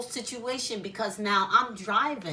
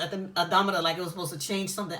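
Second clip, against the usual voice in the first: ordinary delivery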